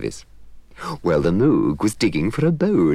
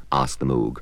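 ordinary delivery, nothing marked

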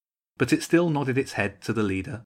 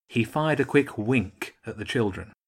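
usual speaking voice

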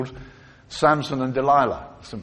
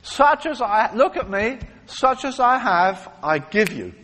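bold acted apostle, higher and louder; Simon; 7 semitones higher, 4 dB louder than usual. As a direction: proclaim it to the man you are healing. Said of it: "this is Simon".

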